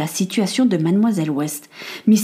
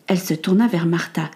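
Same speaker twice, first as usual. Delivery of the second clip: ordinary delivery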